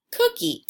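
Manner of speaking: "cookie" blended on a high pitch; blended word. Shown as a demonstration. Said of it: "I changed cook into cookie".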